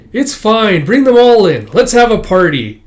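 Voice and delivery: high, loud, acted welcome; jovial invitation. Host to all the tenants, jovial party call. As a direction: call everyone in to celebrate.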